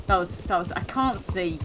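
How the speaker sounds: normal talking voice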